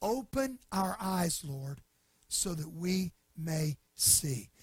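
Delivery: slow, soft plea; a hushed, slow prayer for sight. Real, not acted. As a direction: pray it gently and slowly. Preacher prays himself.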